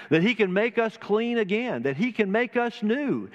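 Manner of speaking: high, ringing preacher's cry, lifted well up; soaring preacher's cry. Acted. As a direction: lift the promise high in fervour. Climax of sermon plea.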